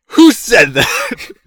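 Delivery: slow, high, very loud mock yell; startled shout. Guy hears a stranger on the phone, startled mock shout. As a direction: holler it, stretched out, as the startled guy.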